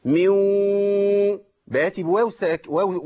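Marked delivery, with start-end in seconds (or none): 0.1-1.4 ميو with the noon fully merged into a nasal waw; idgham demo. Shown as a demonstration. Teacher demonstrating idgham with ghunna. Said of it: "أنا بقول إيه؟".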